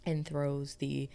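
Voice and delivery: normal talking voice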